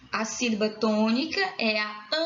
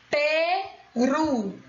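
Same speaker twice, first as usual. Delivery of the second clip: "Peru" in two long, separated syllables; split syllables; 3 semitones higher than usual. Shown as a demonstration. Teacher asking for the tonic syllable, "Peru" split up. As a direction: break "Peru" slowly in two. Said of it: "Qual é a sílaba tônica?".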